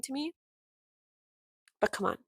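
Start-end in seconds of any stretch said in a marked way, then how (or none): none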